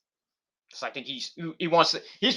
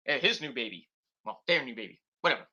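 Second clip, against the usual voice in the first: ordinary talk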